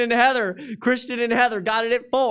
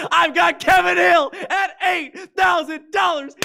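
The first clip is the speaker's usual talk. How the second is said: loud, slow, higher call of the bid; booming auction call; 8 semitones higher, 7 dB louder than usual. Real, not acted. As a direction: belt it out to the room.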